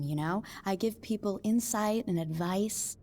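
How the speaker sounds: ordinary talk, nothing marked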